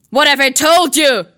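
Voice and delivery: thundering. Acted. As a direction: thunder at the boy. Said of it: "thundered his uncle".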